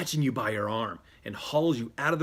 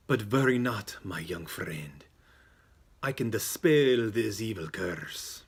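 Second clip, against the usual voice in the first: slow, drawn-out mystic voice; theatrical seer reassuring a client; psychic offering help. Acted. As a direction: slowly intone a grand promise.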